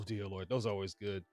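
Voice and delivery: ordinary talk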